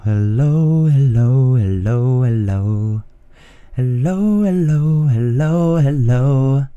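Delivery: many-voiced chorus hello; chorus effect. Shown as a demonstration. Testing the chorus effect on his mixer, a stacked hello. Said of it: "Let's do chorus".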